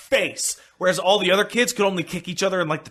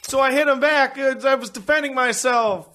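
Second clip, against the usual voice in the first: put-on much higher whiny child pleading innocence; whiny kid excuse; 9 semitones higher than usual. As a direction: play the kid blaming Jimmy to mom. Kid excusing himself to a parent.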